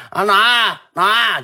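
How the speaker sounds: high, drawn-out shout for grandma; calling out. Retold or imitated. Boy coming home and shouting for his grandmother, calling out. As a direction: holler it long and high into the house. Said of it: "就喊".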